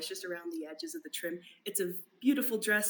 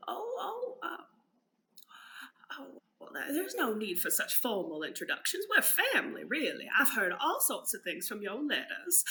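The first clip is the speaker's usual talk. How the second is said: lightly voiced tiny old woman; 4 semitones higher than usual. Old woman waving off formal introductions, lightly voiced. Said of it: "very old woman walk in".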